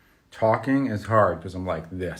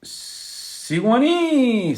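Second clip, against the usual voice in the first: long, slow, much higher call of the name; drawn-out call; 13 semitones higher, much slower than usual. Real, not acted. Streamer calling out a viewer's name in delight. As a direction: sing the name out across the room.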